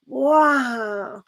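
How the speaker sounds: normal talking voice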